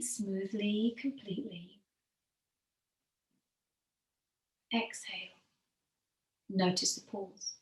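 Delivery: calm yoga teacher easing the class into stillness; guided meditation. Acted. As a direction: guide them gently into the breath, letting phrases sink at the end. Leads breathing meditation.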